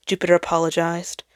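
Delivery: usual speaking voice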